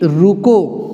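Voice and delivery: रुको drawn out as a command of its own; रुको set apart. Shown as a demonstration. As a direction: stretch रुको, then stop. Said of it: "दूसरा उदाहरण क्या हुआ". (रुको खींचकर, फिर विराम)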